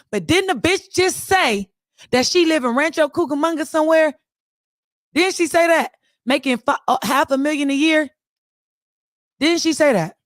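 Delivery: high-pitched, incredulous questioning; pointed disbelief. Real, not acted. Challenging the woman's earlier claim.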